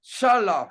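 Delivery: Shalach said sha-LA, open final vowel; partial word sounded out. Shown as a demonstration. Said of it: "hasta aquí estamos escribiendo".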